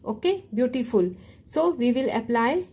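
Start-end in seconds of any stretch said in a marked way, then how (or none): none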